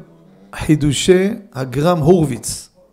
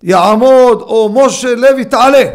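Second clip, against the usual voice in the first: loud, raised synagogue summons; calling out; 5 semitones higher, 9 dB louder, slower than usual. Acted. Gabbai summons a congregant to the Torah, calling out loudly. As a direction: bellow it across the hall.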